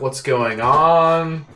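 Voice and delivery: slow, puzzled goblin voice; confused goblins. Acted. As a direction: drawl it out, baffled. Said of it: "They're kind of like".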